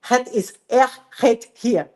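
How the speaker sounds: slow Dutch with the g in "erg" said like a throaty R. Shown as a demonstration. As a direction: say "erg" with an R-like G, slowly. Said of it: "o G tem som de R". (holandês, o G de "erg" com som de R)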